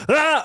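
high mock shout; imitating the scarer's yell. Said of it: "he goes".

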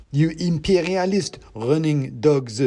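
high, slow, pompous insult; denouncing mouse revolutionary. A narrator's reading. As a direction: denounce them in the revolutionary's character voice.

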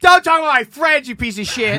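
angry. Pretend. Mock angry at caller.

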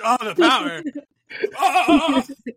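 hammed-up villain glee; gloating laugh. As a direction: cackle over the power you hold. Gloating over co-host's power.

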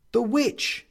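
WITCH pitched high, rising; high, pointed WITCH; man's interjection. A narrator's reading. The man names The witch as goal, high and pointed.

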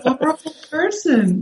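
a mock-scandalised hero scolding; mock outrage. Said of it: "Luke Skywalker is never gonna be like".

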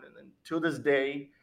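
ordinary delivery

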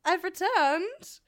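high, loud singsong hello; drunk, oblivious greeting. Retold or imitated. Drunk friend greeting the dejected group in the lobby, oblivious singsong. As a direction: make a grand tipsy entrance, drawn out and loud. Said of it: "I'm drunk, and I'm standing there".

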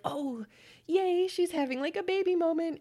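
high, mock-gushing squeal over a baby moment; mock excited. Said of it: "I'd be like".

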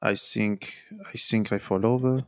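put-on a light Portuguese accent in the Jose Mourinho manner; a lightly voiced Portuguese accent, Mourinho falling over; Mourinho impression. Asked how Mourinho sounded, he does a light Portuguese accent. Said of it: "how did Jose Mourinho go with his accent?".

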